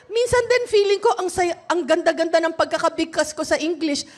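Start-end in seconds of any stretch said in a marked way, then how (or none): none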